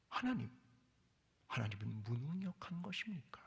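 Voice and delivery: pleas rising, then dropping off; anguished questioning. Acted. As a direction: ask God if he is powerless, pleading. Voices the people's question. (무력한 하나님을 향한 애타는 질문)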